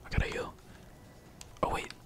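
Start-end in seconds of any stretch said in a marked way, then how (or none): none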